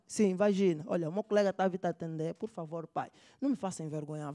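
ordinary talk

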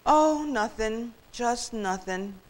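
slow, drawn-out weary answer; listless. Acted. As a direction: sigh it out with no energy. Listless turkey answers goose.

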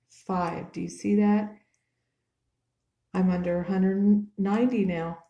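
loud, emphatic excited announcement; thrilled. Real, not acted. Dieter tells viewers the scale finally shows under one ninety, thrilled. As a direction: burst out with real excitement, loud. Sees new low weight on scale.

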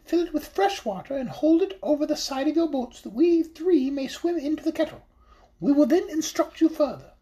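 squeaky-high fish voice, calm and instructive; high magical fish voice. Acted. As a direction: give the orders as a tiny enchanted silverfish. Silverfish gives instructions.

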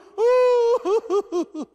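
high, loud mock wail; hammed-up weeping cry; acted wailing cry. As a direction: wail like an old man weeping. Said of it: "he cry and weep".

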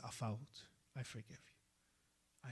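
soft, slow and gentle; hushed tender pardon; tender forgiveness. Retold or imitated. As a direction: forgive him gently, almost softly. Imam forgives the repentant man.

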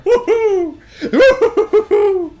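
high, laughing whoops; woohoo cheer. Real, not acted. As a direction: whoop in pure delight. Celebrating the win.

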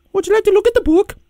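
very high squeaky put-on voice; interrupting book pusher. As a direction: cut in with a relentless high-pitched offer. Repeated interrupting catchphrase.

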